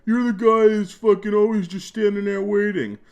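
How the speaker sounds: high, loud mock rant from an annoyed gamer; imitating a furious pubbie; angry player. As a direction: bark it like a fed-up teammate. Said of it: "I'm sure I'm pissing people off. Like".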